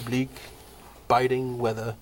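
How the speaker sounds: ordinary delivery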